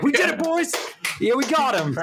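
high, excited victory cheer; triumphant. Retold or imitated. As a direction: celebrate like you cracked it. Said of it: "And I'm like".

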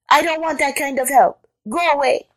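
high-pitched indignant rabbit; indignant cry. A narrator's reading. Rabbit defending the house from Jackal, high indignation. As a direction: refuse in a high outraged voice. Rabbit refuses elephant's help.

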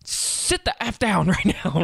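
a parent's half-laughing angry yell; mock yell at a kid. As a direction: whip around and bark at the kid in the back seat. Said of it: "You're just gonna yell at them".

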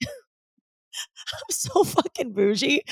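high, drawn-out, laughing self-mockery; laugh, then squeal it out slowly; laughing admission. Real, not acted. Owning up to glamping in the mountains, real laughing admission.

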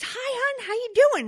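high, bright-voiced greeting as the wife; cheery wife greeting. Wife greeting husband home from work, high and cheery. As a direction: chirp hello to him in a high voice.

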